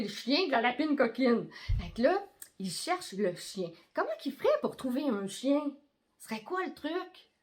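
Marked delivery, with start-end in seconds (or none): none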